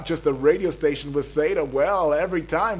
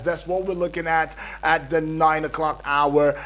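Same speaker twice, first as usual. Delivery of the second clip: usual speaking voice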